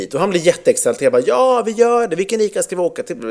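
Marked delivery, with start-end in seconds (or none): 1.3-3.2 bright eager higher yes, then rushing the question; playing along eagerly with the excited child. Dad retelling his cheerful answer to his son, mock eager and quick.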